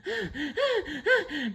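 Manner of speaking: sharp high intake of breath; high gasp. Acted. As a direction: gasp after running. SpongeBob bursts in, breathless.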